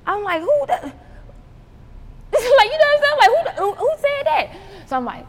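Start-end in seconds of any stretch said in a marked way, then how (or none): 0.4-3.2 very high, loud squeal of disbelief; outraged disbelief. Retold or imitated. Acts out outraged reaction to accusation. 3.2-4.5 very high-pitched incredulous question; outraged disbelief. Retold or imitated. Acts out outraged reaction to accusation.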